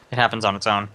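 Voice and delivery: ordinary delivery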